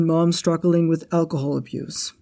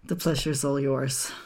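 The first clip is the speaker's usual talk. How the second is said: dry sarcasm; 4 semitones lower, 5 dB quieter than usual. Acted. Parting jab at the manager.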